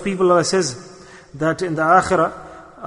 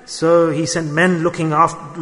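ordinary delivery

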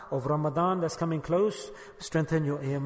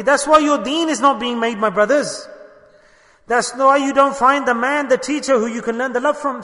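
higher, louder preacher's reproach; preaching rebuke; 6 semitones higher, 7 dB louder than usual. Acted. Imam rebuking his brothers for lacking love, forceful preaching. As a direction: scold the brothers from the pulpit.

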